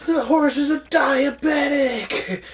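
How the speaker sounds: high, slow exasperated shout. Retold or imitated. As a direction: yell it in disbelief. Quoting a movie shout.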